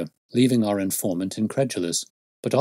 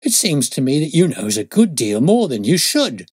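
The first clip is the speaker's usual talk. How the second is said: loud, high, accusing constable; frightened suspicion; 6 semitones higher, 8 dB louder than usual. Acted. Constable suspects the detective knows too much, loud alarmed accusation. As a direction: accuse him in rising alarm. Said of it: "with a frightened face and suspicion".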